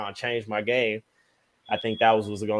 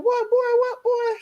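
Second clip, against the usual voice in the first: much higher voice, shocked grandma; alarmed grandma; slower than usual. Retold or imitated. Grandma hearing he broke his leg, high shocked squeak. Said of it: "She like".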